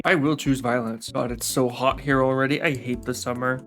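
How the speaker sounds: normal talking voice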